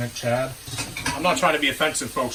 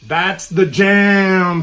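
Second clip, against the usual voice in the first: very slow, drawn-out and louder; slow hype; 4 semitones higher, 7 dB louder, much slower than usual. Pretend. Host hyping the intro to viewers slowly. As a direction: stretch it out like a hype man.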